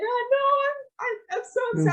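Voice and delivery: high-pitched mock-tearful wail; imitated sobbing. Granddaughter upset on the phone to grandma, imitated sobbing. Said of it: "I was literally sobbing on the phone".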